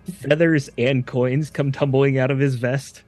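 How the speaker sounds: ordinary talk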